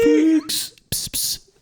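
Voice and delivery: very high coaxing cat call with pss sounds; laughing while calling the cat, high and drawn out. Retold or imitated. Searching Newcastle for the lost cat, high coaxing call.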